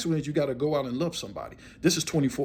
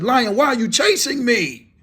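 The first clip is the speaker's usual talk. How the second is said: loud, higher, drawn-out challenge; confronting demand; 7 semitones higher, 5 dB louder, slower than usual. Acted. Dreamer finally facing the lion, loud confronting demand. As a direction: call it out slowly and forcefully.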